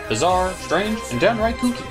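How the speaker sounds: normal talking voice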